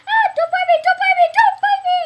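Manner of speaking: high, loud panicked shriek. Real, not acted. Bird biting her.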